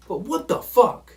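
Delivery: slow, loud what-the-fuck of outrage; exasperated outburst. Real, not acted. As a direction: throw up your hands in disbelief. Ranting at taunting penalty.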